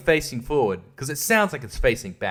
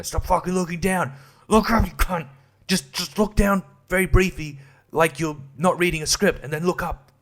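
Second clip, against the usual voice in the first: higher-pitched, fed-up directing; frustrated director's instructions; exasperated telling-off; 7 semitones higher than usual. Retold or imitated. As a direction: retell it as an exasperated plea to an actor. Exasperated direction to actor, retold.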